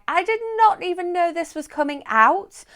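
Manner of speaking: high, loud surprised gasp of disbelief; surprised exclaim. Retold or imitated. Finding the book in Costco, high surprised squeal. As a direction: squeal it like you just spotted it. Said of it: "I was like".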